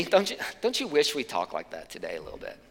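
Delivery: ordinary talk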